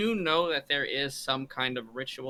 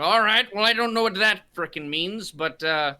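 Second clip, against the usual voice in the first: loud, higher captain's bark; gruff captain; 5 semitones higher, 6 dB louder than usual. Acted. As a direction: order the crew back to work, loud and rough. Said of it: "I go".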